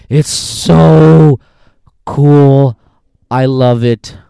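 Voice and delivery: loud, high, drawn-out raving; excited gushing. Real, not acted. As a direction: belt it out like an overexcited fan. Gushing over the racing scenes.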